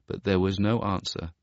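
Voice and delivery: usual speaking voice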